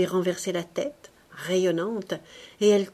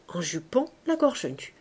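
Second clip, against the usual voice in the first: ordinary delivery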